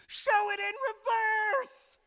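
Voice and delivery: put-on high, drawn-out little-kid shout; little boy's yell. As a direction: yell it shrieky and slow like a small boy. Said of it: "Because he yells".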